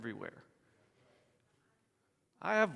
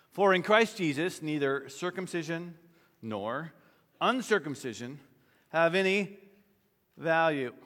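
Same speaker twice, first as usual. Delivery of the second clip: emphatic reading; 5 dB louder than usual. Acted.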